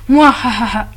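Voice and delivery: evil laugh. Acted. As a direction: cackle like a cartoon villain. Villain letter ends with laugh.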